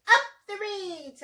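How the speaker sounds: high hoot falling away. A narrator's reading. Reader to children as the happy dinosaur, hooting cheer. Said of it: "and he hooted".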